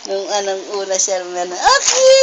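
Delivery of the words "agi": put-on Agi squealed very high, like a baby; high-pitched baby imitation on Agi; imitating baby's cry of Agi. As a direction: mimic a baby piping out "Agi!". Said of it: "Sabi niya sa akin".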